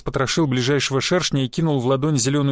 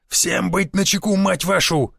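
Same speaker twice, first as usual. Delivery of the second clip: higher-pitched, drawn-out order to the squad; growled command; 5 semitones higher, slower than usual. A narrator's reading. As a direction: snarl a warning, voice raised in pitch. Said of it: "зарычал Шишаков".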